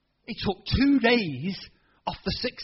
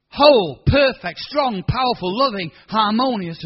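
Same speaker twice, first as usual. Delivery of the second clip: much louder, proclaiming each word; triumphant declaration; 4 semitones higher, 10 dB louder than usual. Retold or imitated. Sick boy declaring himself healed, loud. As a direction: shout each word as a victory.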